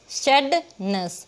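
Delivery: "sadness" broken as sad, ness; split syllables. Shown as a demonstration. Teacher breaks the word for students, "sadness" in two. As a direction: clear pause inside "sadness" after sad. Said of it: "टुकड़े करके देखेंगे".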